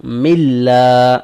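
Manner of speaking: ملا with a light thin lam, stretched slowly; tajweed demo of a light lam; thin light sound. Shown as a demonstration. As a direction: read ملا thin and drawn out. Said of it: "اس کو ہم نے باریک پڑھنا ہے".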